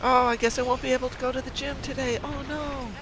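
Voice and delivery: high, loud hammed-up despair; mock horror. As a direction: overplay the tragedy of missing the gym. Mock dismay at missing gym.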